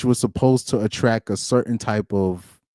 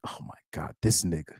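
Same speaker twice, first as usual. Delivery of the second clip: women's whisper; 6 semitones lower, 12 dB quieter than usual. Retold or imitated. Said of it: "all the other chicks were just like".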